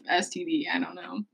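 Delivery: whispered joke aside. Real, not acted. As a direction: breathe it like a secret.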